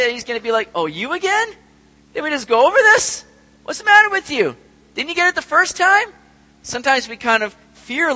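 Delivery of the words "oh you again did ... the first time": high, loud exasperated scolding; mock exasperated God. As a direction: play an impatient, fed-up God. Imagined annoyed God voice.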